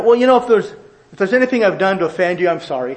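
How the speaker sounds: vague non-apology. Retold or imitated. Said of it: "admit specifically what you did".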